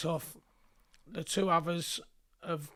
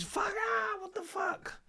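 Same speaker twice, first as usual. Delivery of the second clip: pained cry; slower than usual. Retold or imitated. Victim cries out after chopping.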